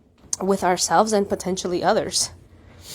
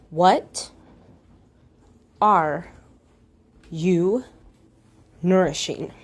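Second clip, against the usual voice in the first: each word alone, long pauses between; slow, word by word; slower than usual. Shown as a demonstration. Guiding listeners to reflect, slow pause after each word. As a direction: let what are you nourishing land one word at a time. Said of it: "So I'm gonna repeat the question again".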